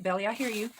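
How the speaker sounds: ordinary delivery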